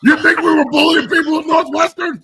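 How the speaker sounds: high, loud shout. Real, not acted. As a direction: yell it in protest. Heated denial to teammates.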